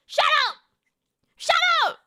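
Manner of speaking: loud high shout of Shut up. Real, not acted. Streamer yelling at chat to shut up, loud shout. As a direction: yell it at the chat.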